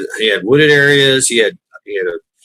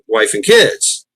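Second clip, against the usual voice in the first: ordinary talk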